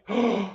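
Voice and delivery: sharp surprised gasp; a real startled intake of breath. Real, not acted. Vlogger seeing his heroes join the stream, gasping.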